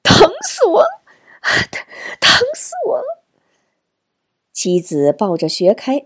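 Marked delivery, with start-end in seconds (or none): 0.0-3.2 put-on very high, agonized child's cry; shouting in pain. A narrator's reading. Son holds his head in his mother's arms, high pained wail. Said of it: "直嚷着".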